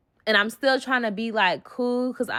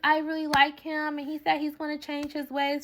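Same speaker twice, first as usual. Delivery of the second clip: mock smitten friend; 6 semitones higher than usual.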